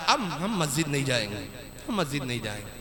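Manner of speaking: very low, soft mimicry; mock muttering. Lapsed worshippers quitting the mosque, mock muttering. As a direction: mutter it under your breath.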